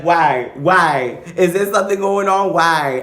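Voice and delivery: loud, high, frantic questions; alarmed. Retold or imitated. Questioning the hookup mid-act, loud and frantic. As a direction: demand answers, panicking. Retold panic over the pause.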